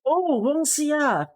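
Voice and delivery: a real glad oh; delighted surprise. Real, not acted. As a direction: greet the name with wonder. Finding a viewer's name.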